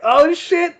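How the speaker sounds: sudden high-pitched "Oh, shit"; stunned surprise. Retold or imitated. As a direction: jump way up in pitch, caught off guard. Stunned he is still standing.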